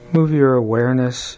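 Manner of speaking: usual speaking voice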